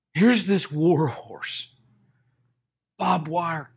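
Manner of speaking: usual speaking voice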